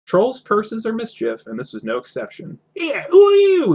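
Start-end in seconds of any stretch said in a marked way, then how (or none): 2.8-3.7 a loud, very high squeak; squeaky. Acted. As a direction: squeak it out like a startled little purse. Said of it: "it squeaked".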